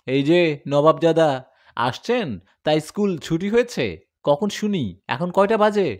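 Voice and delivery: a stern father telling off his late son; scolding father. Acted.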